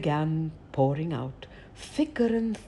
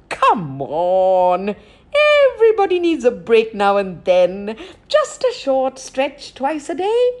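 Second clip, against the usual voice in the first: coaxing Raven; 5 semitones higher, 7 dB louder than usual. Acted.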